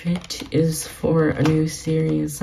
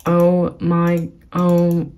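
freaking out; 4 dB louder, much slower than usual. Real, not acted. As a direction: stretch every word out in overwhelmed excitement. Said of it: "I'm, like, freaking out right now".

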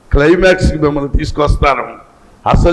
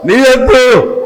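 slow, high, drawn-out Bible reading; solemn pulpit reading with stretched phrases; scripture declamation; 8 semitones higher, slower than usual. Acted. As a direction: proclaim each phrase of the verse slowly and high.

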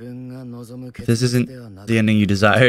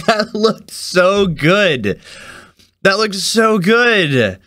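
higher-pitched, slowly stretched praise; thrilled amazement; 6 semitones higher, much slower than usual. Real, not acted. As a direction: savour each word, blown away. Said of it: "I am so excited".

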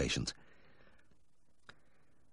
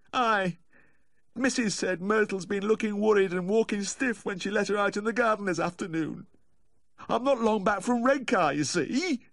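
very high, anxious Humphrey figure; worried caller, pitched right up; distraught dog owner. Acted. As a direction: plead the case in a high, fretful voice.

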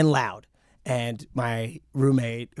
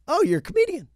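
much higher, imitated woman's voice; delighted woman neighbor; faster than usual. Woman neighbor on learning he's a comedian, high delighted imitation. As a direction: pipe up as a suddenly won-over neighbor.

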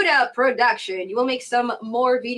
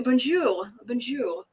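put-on French accent; 3 semitones higher, slower than usual. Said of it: "my French accent".

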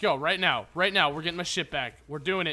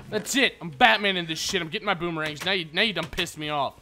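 ordinary delivery

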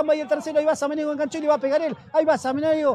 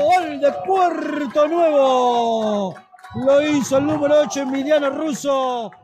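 slow, louder drawn-out goal call; excited goal call; 4 dB louder, slower than usual. Real, not acted. Goal announcement.

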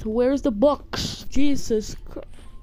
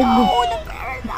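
panicked shout; faster than usual. Real, not acted. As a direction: cry out as the parent is lost. Panic as parent falls.

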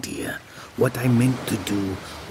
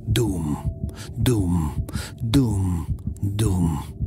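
heartbeat thuds; 3 semitones lower, much slower than usual. Acted. Heartbeat sounded as words.

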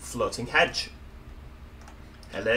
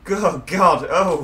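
nervous; 4 semitones higher, 9 dB louder, much slower than usual. Real, not acted. Scared of heights at edge.

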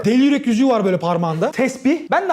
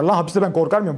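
ordinary talk

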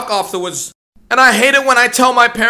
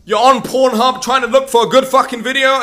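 ordinary delivery

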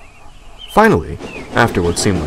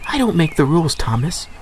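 higher, matter-of-fact message; Percy voiced; 8 semitones higher than usual. A narrator's reading. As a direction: pass on the boss's order. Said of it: "said Percy".

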